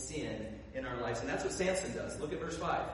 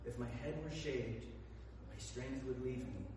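hushed confession; 5 semitones lower, 9 dB quieter than usual. Acted. Samson finally confesses his secret.

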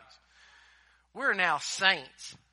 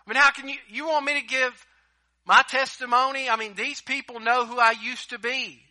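high, loud, exasperated protest; pleading protest; 7 semitones higher, 10 dB louder than usual. Retold or imitated. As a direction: cry out to God that you can't do it. Acting out Gideon's protest.

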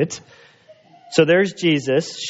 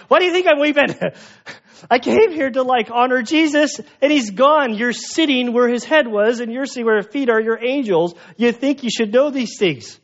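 exasperated Mary; 9 semitones higher than usual. Retold or imitated. Mary venting to the angels at the empty tomb, exasperated and high. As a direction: pitch it way up, fed up and incredulous.

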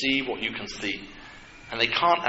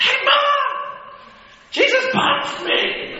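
put-on much higher, loud whiny kid calling Mom; sibling telling on Jesus to Mom, whining; tattling child; 5 dB louder than usual. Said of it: "can you imagine, like".

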